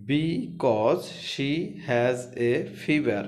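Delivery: words stretched out slowly; slow dictation of a sore throat; very slow reading. Shown as a demonstration. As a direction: drag it out word by word. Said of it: "teacher reads sentence slowly".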